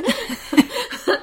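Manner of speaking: loud, high laugh; high burst of laughter. Real, not acted. Laughs at own accent.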